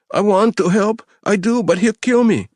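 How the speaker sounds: high, tearful and pleading; a terrified captive crying for mercy; sobbing plea. A narrator's reading. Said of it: "he sobbed".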